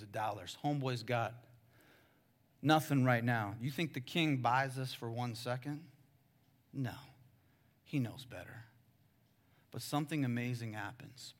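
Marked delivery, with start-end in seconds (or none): none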